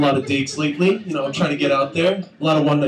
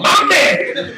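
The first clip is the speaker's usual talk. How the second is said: high whooping Denzel voice; Denzel impression; 12 semitones higher than usual. Comic doing Denzel in bed, high whoop. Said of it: "I started going".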